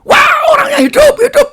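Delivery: high, loud cry of amazement; re-enacted astonished shout. Mourners see the dead woman alive, astonished shouting. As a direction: yell out in mock shock.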